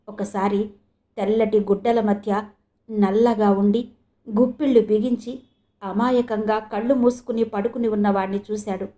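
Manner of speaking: ordinary delivery, nothing marked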